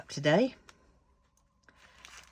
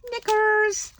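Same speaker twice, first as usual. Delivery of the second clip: high, loud exasperated cry; exasperated exclamation; 9 dB louder than usual. Real, not acted. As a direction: blurt it out in frustration. Annoyed at the wrong bead colour.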